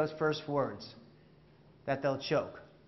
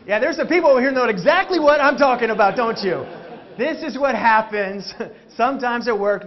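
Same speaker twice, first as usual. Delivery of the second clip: raised, high voice over laughter; loud teasing; 3 semitones higher, 8 dB louder than usual. Real, not acted. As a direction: call the audience out, loud and higher. Teasing audience over their laughter.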